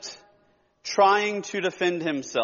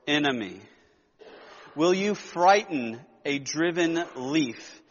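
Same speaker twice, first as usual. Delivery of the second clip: hushed solemn scripture read; 5 semitones lower than usual. Acted. Reads scripture passage aloud.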